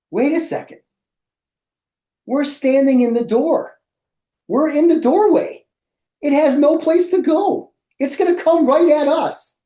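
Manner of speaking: high, urgent panicky warning; alarmed realization. Retold or imitated. Warning his host mom in the doorway, high alarmed voice. Realizing lizard will charge them.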